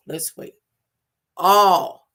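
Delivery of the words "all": "All" much louder, standing alone; stress on ALL. Real, not acted. Speaker repeats it so the word lands, emphatic "All". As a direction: hit "All" hard.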